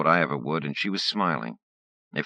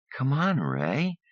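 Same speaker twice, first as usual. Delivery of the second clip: high, slow, drawn-out whine; whiny pleading; 10 semitones higher, slower than usual. Acted. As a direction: stretch it out, coaxing. Andrea whines at Ray.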